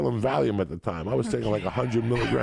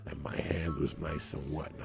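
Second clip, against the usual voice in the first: hushed; 5 semitones lower, 6 dB quieter than usual. Acted. Hushed dirty storytelling.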